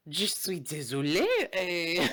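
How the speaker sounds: put-on French apology; fake French accent. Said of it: "with your fake-ass French accent".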